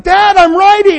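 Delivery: put-on child calling to dad mid-ride, high and excited. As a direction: shout it like a kid proud on the bike. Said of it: "and they look".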